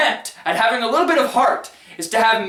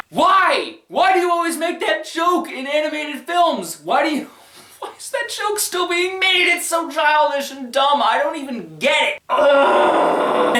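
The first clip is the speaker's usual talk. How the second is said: high, straining comic rant; hammed-up high-pitched exasperation; exasperated outburst; 8 semitones higher than usual. Exasperated rant at a dumb joke.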